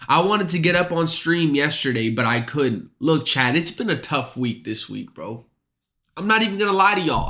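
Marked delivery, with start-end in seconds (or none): 6.2-7.3 fast. Real, not acted. Repeated confession.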